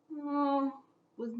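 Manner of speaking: ordinary delivery, nothing marked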